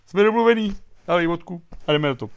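Andrej. Acted. Politician agreeing a deal with the communists, lightly acted gusto. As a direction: seal the deal with a mock hearty call. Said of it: "Andrej řekl".

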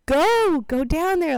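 loud, high thrilled urging; excited mom. Retold or imitated. Mom on the phone urging daughters down, loud and excited. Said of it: "And she was like".

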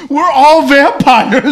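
mock exclamation. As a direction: declare it big, stretching the words. Mocking the villain's big excuse.

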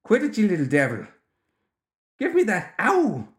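rising exasperated shout ending in a yelp. A narrator's reading. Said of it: "he was shouting".

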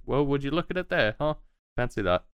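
high little man's voice, amused. Acted. Little man marvels at the returned horses, high. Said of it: "the little man says".

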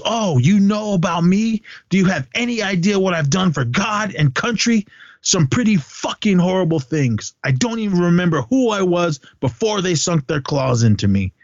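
high-pitched indignant movie rant; impassioned imitation of an angry tirade; retold yelling. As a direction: act out an outraged outburst without real volume. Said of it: "he starts yelling at him".

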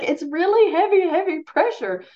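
emphatic. Real, not acted. Stressing heavy pressure on moms.

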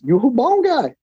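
a hint of a Brazilian coach; coach's voice. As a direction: voice the coach with a lifted pitch. Voicing opponent's question.

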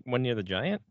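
ordinary talk, nothing marked

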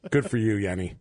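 ordinary talk, nothing marked

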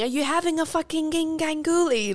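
high put-on accent, joking imitation; Ant Middleton. Mocking an instructor telling recruits off, put-on accent. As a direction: mimic his accent, pitched well up. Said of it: "Ant saying".

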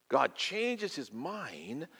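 slow, drawn-out words; slow emphasis. Acted. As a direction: let each word land slowly.

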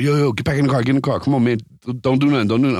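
mock panicked warning; imitated freaking out. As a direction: imitate a friend frantically calling someone back. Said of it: "my boy is freaking out".

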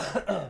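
a short real cough; one dry cough while under the weather. Real, not acted. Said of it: "Sorry for coughing".

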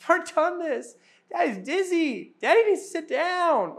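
a dizzy dad begging his daughters to let him rest; worn-out dad. Retold or imitated. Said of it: "And I'm going".

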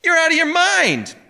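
loud, very high howl of protest; outraged high yell; incredulous howl. A narrator's reading. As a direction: cry out in wild disbelief. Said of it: "incredulous howl".

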